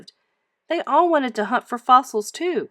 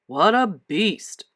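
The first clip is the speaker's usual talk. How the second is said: a hint of amazed men; much slower than usual. Townsmen marvel at the giant bones, slow and gruff. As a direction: linger on it in awe. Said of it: "they cried".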